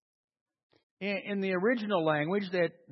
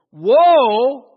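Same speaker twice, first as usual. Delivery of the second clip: "woe" very loud and held; forceful, stretched proclamation; emphatic warning; 3 semitones higher, 12 dB louder than usual. Shown as a demonstration. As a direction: boom out a long "woe". Returns to the emphatic warning word.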